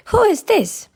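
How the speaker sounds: high, indignant demand; high-pitched rude outburst; rude shout. A narrator's reading. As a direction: demand it rudely, pitch jumping way up. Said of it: "he shouted rudely".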